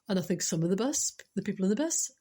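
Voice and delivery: usual speaking voice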